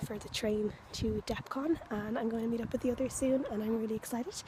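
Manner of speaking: hushed, low-level vlog voice; talking quietly. Real, not acted. Vlogger among a crowd waiting for the train, talking quietly. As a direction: speak softly so people nearby don't hear. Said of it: "so I'm talking kinda quietly".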